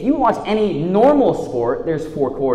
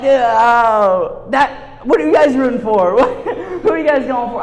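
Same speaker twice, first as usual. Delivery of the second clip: nervous laugh; 5 semitones higher, 4 dB louder than usual. Retold or imitated. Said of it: "I'm like".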